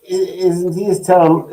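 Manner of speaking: ordinary delivery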